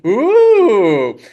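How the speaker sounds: high, loud, drawn-out "ooh"; excited ooh. Acted. Po watching vegetables get blended, excited high ooh. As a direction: squeal it with mounting delight. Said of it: "He's even more excited".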